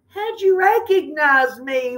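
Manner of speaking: high, lightly wailed question; a small mock lament. A narrator's reading. Turkey caught again, a light high wail. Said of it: "wailed Turkey".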